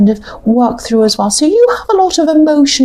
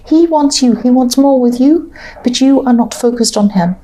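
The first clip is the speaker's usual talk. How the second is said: ordinary talk, nothing marked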